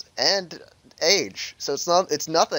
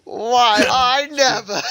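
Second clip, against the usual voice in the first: high, loud, slow mock indignation; drawn-out, hammed-up bad orator voice; Martin Luther King Jr; 10 semitones higher, 6 dB louder, much slower than usual. Cracking up friends, slow indignant orator impression. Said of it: "worst Martin Luther King impression".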